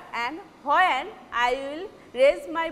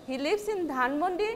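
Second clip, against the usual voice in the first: "He lives in Dhanmondi" turned into a question by a rise on Dhanmondi; brisk question, Dhanmondi going up at the end; rising question tune; 2 semitones higher, faster than usual. Shown as a demonstration. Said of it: "from my voice you can understand that this is question".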